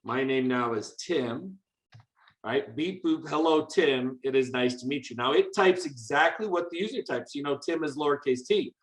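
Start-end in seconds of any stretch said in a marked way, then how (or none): none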